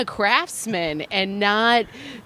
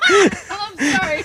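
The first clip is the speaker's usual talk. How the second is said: slow, high, breaking into laughter; giggling I'm sorry; laughing apology; 11 semitones higher, much slower than usual. Real, not acted. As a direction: apologize while cracking up. Cracking up at detail.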